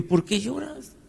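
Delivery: husband asking his wife why she cries, high and soft; gentle question. Retold or imitated. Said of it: "Le digo".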